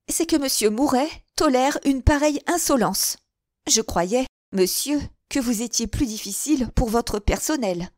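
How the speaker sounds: high, loud indignation at monsieur Mouret; indignant reproach. A narrator's reading. Henriette reproaching Mouret over his employee, indignant. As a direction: shame him for tolerating it.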